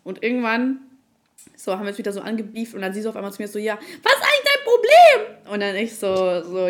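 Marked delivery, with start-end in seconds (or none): 3.6-5.5 high, loud mock-bitchy girl voice; sneering imitation of the rival girl. Imitating the rival girl snapping at her in the club, high and loud.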